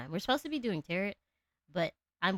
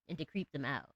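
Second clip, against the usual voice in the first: ordinary talk, nothing marked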